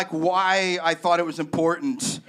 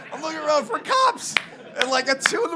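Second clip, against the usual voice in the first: same line said much higher, rising at the end; higher take; 9 semitones higher, faster than usual. Shown as a demonstration. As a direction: lift it up into a worried rise.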